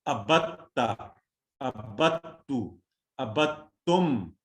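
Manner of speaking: अबत्ता, अबत्तु, अबत्तुम said very slowly, each falling; slow drilling. Shown as a demonstration. Teacher drills class, very slow model reading. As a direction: spell out each form slowly. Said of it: "अरबी कुलिये पर".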